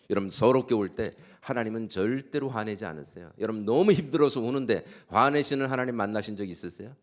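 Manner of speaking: high, loud, fervent preaching; preaching at full force. Acted. Pastor proclaiming good news to the congregation, fervent loud preaching.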